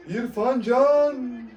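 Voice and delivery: building, drawn-out high call; screaming football commentator. Real, not acted. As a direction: stretch the name as the chance builds. One-on-one chance live.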